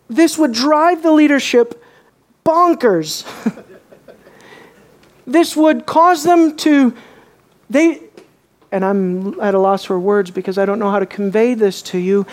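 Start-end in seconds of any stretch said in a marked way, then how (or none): 0.1-8.0 rising excitement at the leaders' plight; excited, higher. Real, not acted. Preacher grows excited about the leaders.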